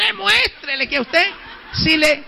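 high, laughing urging; laughing churchwoman. Retold or imitated. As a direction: egg him on while laughing. Woman urging, breaking into laughter.

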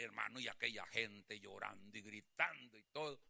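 ordinary talk, nothing marked

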